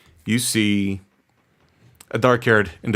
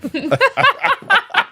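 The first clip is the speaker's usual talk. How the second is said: high whinnying laugh; horse nicker; 4 dB louder than usual. Acted. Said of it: "That's my horse voice".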